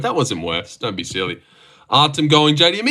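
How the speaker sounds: normal talking voice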